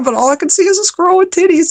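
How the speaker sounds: high, squeaky near-laughter; real laughter taking over the words; helpless giggling. Real, not acted. Guest losing it over the squirrel image, high and giggly.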